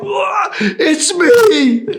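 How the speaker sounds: high, loud cry to be recognized; desperate shout. Retold or imitated. Guard yells to his armed team that it's him, high and loud. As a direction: yell so they hold fire.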